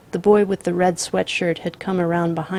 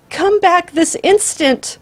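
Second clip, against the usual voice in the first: high, loud, slow shrill command; a mother's shrill cry after her son; 12 semitones higher, 8 dB louder, slower than usual. A narrator's reading. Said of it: "his mother shrilled".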